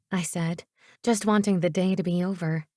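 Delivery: ordinary delivery, nothing marked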